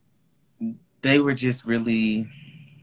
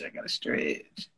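whispered aside; 10 dB quieter than usual. Real, not acted. Reminding herself to stretch.

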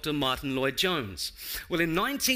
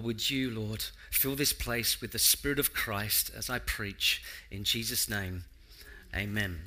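lower-pitched, measured public prayer; 4 semitones lower than usual. Real, not acted. Preacher praying to the Lord before he preaches, prayerful. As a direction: pray earnestly and evenly, letting the phrases settle.

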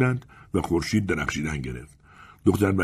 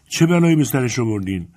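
raised, higher accusing voice; indignant shout; 5 semitones higher, 4 dB louder than usual. A narrator's reading. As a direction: cry out the question, louder. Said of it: "آنسلما داد زد".

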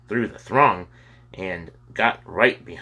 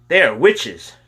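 eager warning; 6 semitones higher, 7 dB louder than usual. A narrator's reading. Boy eagerly warning the firemen.

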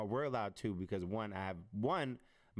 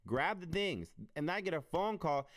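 normal talking voice

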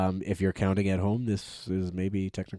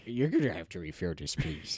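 cat voice; 4 semitones lower, 5 dB quieter than usual. Pretend. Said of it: "Those are our cat voices".

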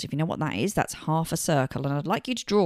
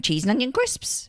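funny voice; 7 semitones higher than usual. Retold or imitated. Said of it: "in a funny voice".

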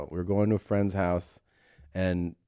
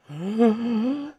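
high, drawn-out reenacted gasp; horrified gasp; 14 semitones higher than usual. Seeing the man's head open, horrified gasp. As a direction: suck in air in mock horror.

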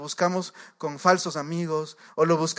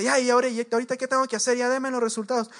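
fast, higher-pitched impatient demand; mock impatient demand; 7 semitones higher, faster than usual. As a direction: rush the nurse for the results. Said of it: "la señora más mala actitud". (exigencia impaciente, rápida y aguda)

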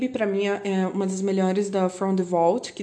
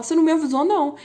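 higher and louder protest, hamming it up; mock outraged reply; 7 semitones higher, 5 dB louder, much faster than usual. Vlogger acting the other Exile singer, mock outraged. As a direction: fire back you never warned me. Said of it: "aí o outro fala".

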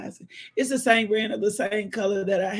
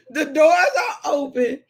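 ordinary talk

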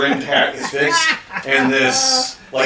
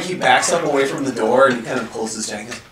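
normal talking voice

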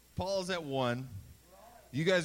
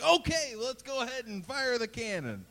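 cheery guide voice; 6 semitones higher than usual. Retold or imitated. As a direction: push on brightly like a guide. Retold guide moving on.

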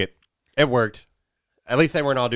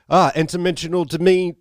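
put-on higher Liverpool accent; 8 semitones higher than usual. Quoting [name] in a lightly [name] Liverpool accent. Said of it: "You went kind of [name] on us".

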